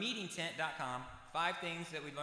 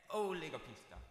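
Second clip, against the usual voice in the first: ordinary talk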